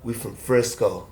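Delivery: quiet, low quoted pep talk; low, quiet challenge. Retold or imitated. Retelling friend's private challenge to him.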